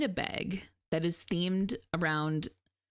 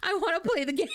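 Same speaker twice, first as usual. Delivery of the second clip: eager whine; 9 semitones higher than usual. Real, not acted. Eager about the game.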